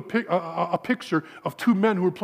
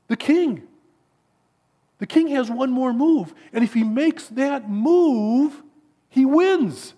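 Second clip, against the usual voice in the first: high, loud, building exclamation; excited chess champion driving it home; triumphant revelation; 8 semitones higher, 5 dB louder, slower than usual. Acted. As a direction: announce the winning discovery, stretching it out slowly toward the end. Champion reveals the winning move.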